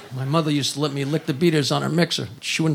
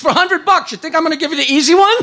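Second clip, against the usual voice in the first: high, loud comic indignation; indignant retort; 10 semitones higher, 4 dB louder than usual. Acted. Customer to a hooker over money, indignant. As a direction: build it fast to an incredulous punchline.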